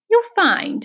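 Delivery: usual speaking voice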